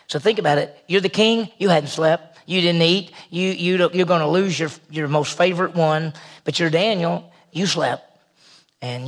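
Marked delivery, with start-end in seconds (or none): none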